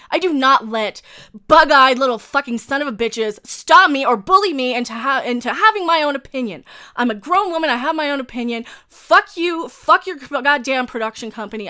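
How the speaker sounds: loud, defiant real fury; unplanned angry outburst. Real, not acted. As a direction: blow up at the bullies, loudly. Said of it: "that wasn't planned".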